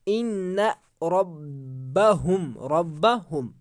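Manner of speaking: slow chanted recitation, the m hidden in the nose in रब्बहुम; Quran recitation. Shown as a demonstration. Teacher showing students where ikhfa falls, slow chanted recitation. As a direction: stretch रब्बहुम slowly with a nasal hum on the m. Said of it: "चलो देखते हैं कहाँ पर हमारा इख्फा होगा".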